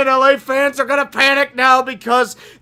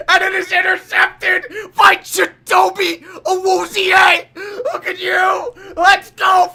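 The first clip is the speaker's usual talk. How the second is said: higher shouted call, slow and drawn out; interception call; 7 semitones higher, 3 dB louder, slower than usual. Acted. Calling the Bengals interception for chat, ecstatic shouting. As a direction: scream it like a broadcaster losing it.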